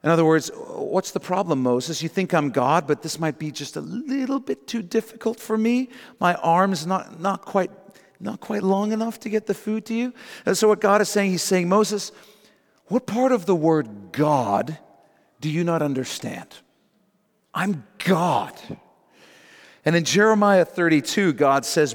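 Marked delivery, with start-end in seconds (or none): 0.5-10.1 high, mock-helpless sarcasm; needling, pitched-up feigned doubt; God, sarcastic. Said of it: "God sarcastically replies". 11.7-18.5 slow, indignant rebuke, each phrase set apart; affronted, deliberate dressing-down; God, indignant. Acted. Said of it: "he's indignant. He's insulted by Moses' question".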